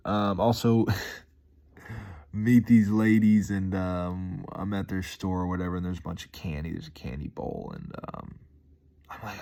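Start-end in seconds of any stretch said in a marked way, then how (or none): none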